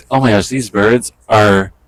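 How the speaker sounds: normal talking voice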